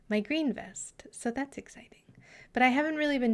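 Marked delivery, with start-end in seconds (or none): 1.1-2.0 whispered. Real, not acted. Whispered aside.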